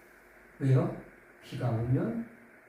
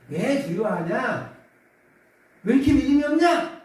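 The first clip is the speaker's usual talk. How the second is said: rebuke; 9 semitones higher, 6 dB louder than usual. Retold or imitated. Jesus rebuking the frightened disciples, scolding. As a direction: scold them for their fear. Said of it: "책망하시는 거예요".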